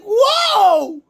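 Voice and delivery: loud high astonished cry; amazed whoa. Retold or imitated. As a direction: blurt it out in awe. Reliving the crowd reaction.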